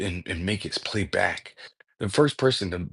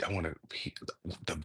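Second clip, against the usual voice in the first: whisper; 3 semitones lower, 14 dB quieter, much faster than usual. Real, not acted. Trails into a whisper.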